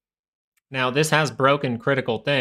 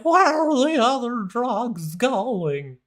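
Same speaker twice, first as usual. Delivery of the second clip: Batman voice; 12 semitones higher, much slower than usual. Retold or imitated. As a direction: parody Batman, much higher and slow. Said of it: "[name] likes the Batman voice".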